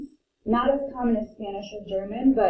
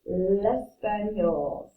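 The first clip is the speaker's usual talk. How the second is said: slowly, each French word spelled out in sound; teacher pacing French words at half speed; slow French demo; 4 dB louder, much slower than usual. Shown as a demonstration. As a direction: model les langues, le français, l'espagnol slowly for the class. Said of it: "it blends in".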